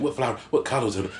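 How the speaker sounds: high, quick-fire poetic refrain. Retold or imitated. Imitating the poet performing his refrain, in high incantatory delivery. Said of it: "But you hear him say it".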